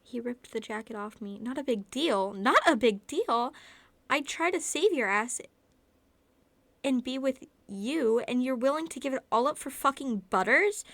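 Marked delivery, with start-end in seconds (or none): 1.4-3.5 furious yelling, building louder; angry shout. Acted. Kenny furious at narrator. 4.1-10.8 furious, loud yelling; angry shout. Acted. Kenny furious at narrator.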